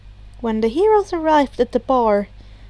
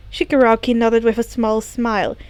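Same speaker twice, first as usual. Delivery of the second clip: usual speaking voice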